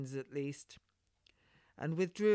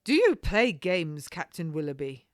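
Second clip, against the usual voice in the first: pressing him, angry yet curious; 9 dB louder than usual. Acted. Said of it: "both angry and curious".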